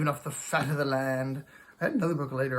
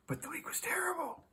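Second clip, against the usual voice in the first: a whispered, much higher, breathy confession; whispered aside; 7 dB quieter than usual. Real, not acted. Vlogger admitting his bad week, whispered aside. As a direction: let it slip out in a whisper.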